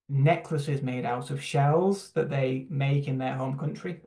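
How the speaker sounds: normal talking voice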